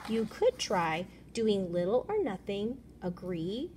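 usual speaking voice

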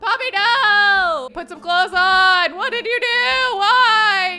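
high, loud, drawn-out scolding wail; real shrieked dismay, pleading by the end; horrified yelling. Real, not acted. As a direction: cry out in shock at a misbehaving character. Shocked at naked game character.

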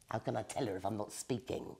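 low soft sulky grumble; grumbling witch. A narrator's reading. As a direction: sulk it out. Witch sulking.

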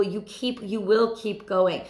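ordinary talk, nothing marked

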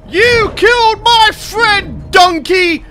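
slow, high, loud accusation; hammed-up vengeful shout; mock outrage. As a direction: stretch each word out in theatrical fury. Yelling at creature that killed mount.

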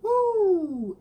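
high, loud whoop; excited woo. Real, not acted. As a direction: whoop with joy. Excited about the song.

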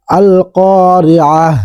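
"আল কারিআহ" ending in a stopped h, slow; pausal form. Shown as a demonstration. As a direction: recite "আল কারিআহ" stopping on the final h. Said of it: "ওয়াকফ করলে উচ্চারণ হবে এইভাবে". ("আল কারিআহ" থেমে হ দিয়ে শেষ)

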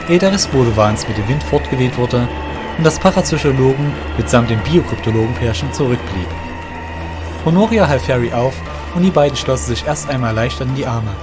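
ordinary delivery, nothing marked

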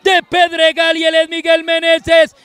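long goal cry, pitched high; goal call. Real, not acted. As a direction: hold the goal cry at full voice. Penalty goal scored.

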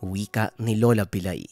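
ordinary delivery, nothing marked

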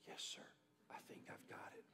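barely audible, whispered reply; cowed whisper. Retold or imitated. Son answering dad after a quiet warning, cowed whisper. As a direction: imitate a chastened boy whispering.

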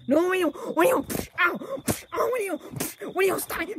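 high, loud cries under attack; protesting yell. Acted. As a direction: yelp and plead through the blows. Being hit in an acted fight.

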